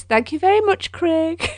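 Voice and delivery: high, mock-gracious thank you; sarcastic thanks. Real, not acted. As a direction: thank him with a teasing smirk.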